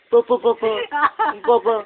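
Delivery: calling out. Real, not acted. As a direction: call papa from afar. Calling out to father.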